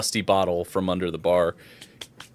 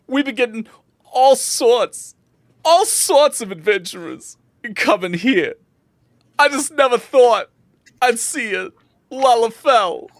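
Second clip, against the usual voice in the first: delighted bartender; 13 semitones higher, 5 dB louder than usual. Acted. Bartender welcoming an adventurer answering the king's call, high and delighted. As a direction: marvel loudly at the newcomer, pitched high.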